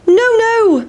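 sing-song no to the chasers; high-pitched cheeky pancake. Acted. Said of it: "said the big pancake".

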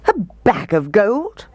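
high-pitched narrated outrage; indignant roar. A narrator's reading. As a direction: exclaim it in mock disbelief. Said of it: "roared the mayor".

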